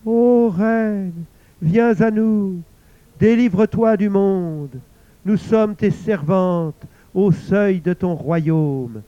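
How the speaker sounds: high, loud, slow wailing call; hanged women wailing to the queen, high and slow; lamenting chorus. A narrator's reading. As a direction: moan it like hanged ghosts. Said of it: "se mirent à gémir si lamentablement".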